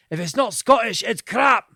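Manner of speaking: loud, high Scottish shopkeeper sketch; hammed-up Scottish sales pitch, punching the key words; mock Scottish accent. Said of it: "Scottish store".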